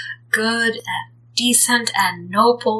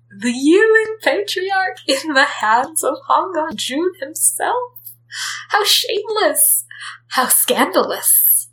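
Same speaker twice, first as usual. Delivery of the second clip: much higher, mock-shocked gossiping voice; scandalised gossip; 8 semitones higher than usual. The cultivation world gossips about the Yiling Patriarch in higher mock outrage. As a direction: clutch your pearls over the scandal.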